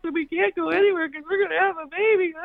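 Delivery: hammed-up weepy whine, very high-pitched; tearful wailing. Pregnant wife melting down to her husband, mock tearful wail. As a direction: imitate your own high, sobbing meltdown. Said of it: "I start crying".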